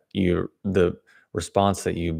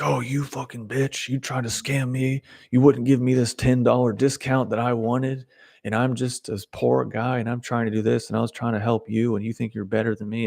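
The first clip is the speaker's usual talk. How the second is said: higher pitched, indignant mock outburst; angry buyer rant; 5 semitones higher than usual. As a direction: play the entitled buyer lashing out. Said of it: "'Cause it's like".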